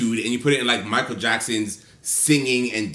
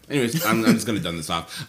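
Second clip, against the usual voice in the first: ordinary delivery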